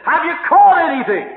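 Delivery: high, loud hail; acted shout from a distance; calling out. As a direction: call across the water to fishermen. Calling from beach to boat.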